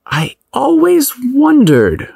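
high, slow, dreamy mock-innocence; innocent teasing. A narrator's reading. Man teases his prince-costumed date, mock-innocent and drawn-out. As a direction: draw it out high like a swooning innocent, then drop. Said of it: "Holt innocently teased".

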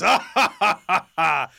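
loud, high put-on belly laugh; old lion's laugh. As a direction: throw out a big mock laugh, "ha" by "ha". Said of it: "old man lion kind of laughs".

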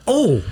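a sudden high yelp of mock pain; retold ouch, jumped up high. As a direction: yelp like the needle just hit. Needle jabbed into his cheek.

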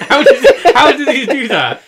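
high and loud through laughter; cracking up mid-question; laughing. Real, not acted. Cracking up about fireplace.

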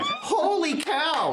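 high, slow, laughing amazement; amazed excitement. Real, not acted. Game master reacts to the player's great roll, amazed. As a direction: stretch it out high in delight.

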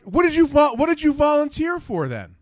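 high, loud incredulous retort; outraged, questioning his nerve; indignant disbelief. Retold or imitated. Retold indignant reply.

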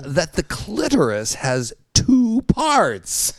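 high, slow mock proclamation; high-pitched voice. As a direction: imitate him announcing it slowly up high. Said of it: "not that high voice".

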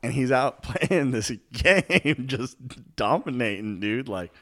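giggling while describing; trying to hold in a laugh; stifled giggles. Real, not acted. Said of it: "You're not supposed to laugh".

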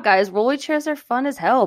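usual speaking voice

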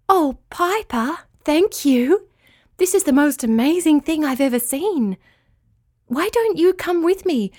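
high-pitched, amazed thanks; delighted gratitude. A narrator's reading. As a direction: gush high with wonder and thanks. Winky amazed, thanks Piper for gift.

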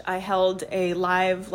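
ordinary talk, nothing marked

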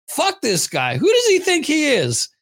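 indignant retort, leaping high on the question; mock indignation. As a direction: act out scornful disbelief, pitch jumping up. Acts out his indignant past reaction.

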